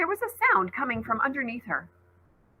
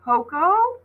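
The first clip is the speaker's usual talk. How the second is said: a high, louder call into the corn; anxious call across the field; 9 semitones higher, 4 dB louder than usual. Acted. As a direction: call for her lost friend. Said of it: "she called".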